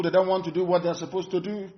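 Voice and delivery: ordinary talk, nothing marked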